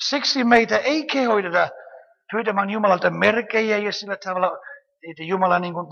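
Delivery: ordinary talk, nothing marked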